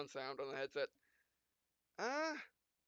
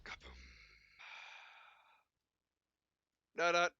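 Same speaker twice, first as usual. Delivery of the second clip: hammed-up anime pilot, punchy and loud in the fights; dramatic hero narration; 6 semitones higher, 4 dB louder than usual. Gamer voicing his pilot through a close mech duel, hammed-up. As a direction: play the frantic mech pilot mid-duel.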